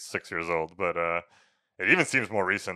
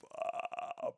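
a choked unvoiced creature rasp; the husband teased with the creepy voice; Babadook voice; 15 dB quieter than usual. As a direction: start the monster's name as a strangled rasp. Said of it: "if you hadn't done that voice".

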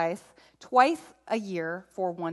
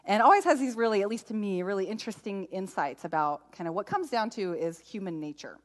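usual speaking voice

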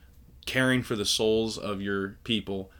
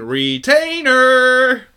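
high, loud, long-drawn cry; exaggerated movie-quote holler; Chucky; 13 semitones higher, 9 dB louder, much slower than usual. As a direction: stretch the word out in a high, slow yell. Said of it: "It's Chucky saying".

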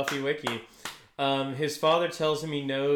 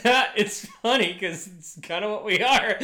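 laughing quote; 7 semitones higher, 6 dB louder than usual. Retold or imitated. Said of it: "I'm like, laughs".

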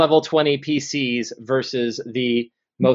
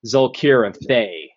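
ordinary talk

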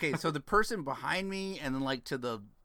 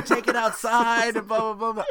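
retold yelling; 7 semitones higher, 4 dB louder than usual. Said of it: "And everybody's yelling".

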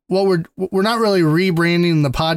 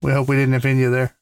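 ordinary talk, nothing marked

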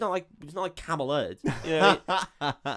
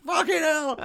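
high, loud, laughing panic; mock panic; 6 dB louder than usual. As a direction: squeal it in alarm.

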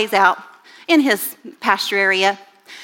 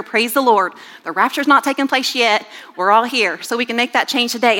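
ordinary talk, nothing marked

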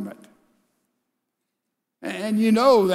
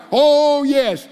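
mock insistence; 7 semitones higher, 6 dB louder than usual.

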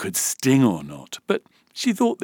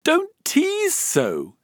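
put-on annoyed girl; 4 dB louder, much slower than usual. A narrator's reading. Alice scolds the voice.

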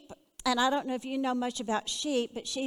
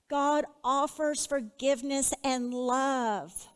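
usual speaking voice

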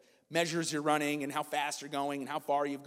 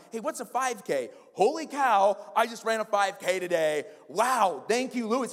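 louder, higher delighted surprise; mock amazed; 7 semitones higher, 9 dB louder than usual. Pastor realizing he ran a five K, excited. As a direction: burst out in happy wonder.

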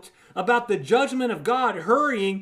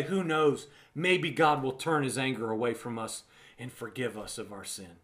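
hushed plea; 8 semitones lower, 6 dB quieter than usual. Acted. Voicing Nineveh's hopeful plea.

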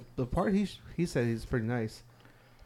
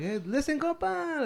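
put-on high, singsong Texas Mexican accent; mock the painter's Texas Mexican drawl, pitched up; imitating Texas Mexican accent; 14 semitones higher, slower than usual. Retelling a phone call, mimicking the painter's Texas Mexican accent. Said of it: "they talk like that".

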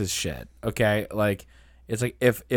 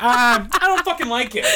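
mock grumpy complaint; 10 semitones higher than usual. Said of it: "literally was like".

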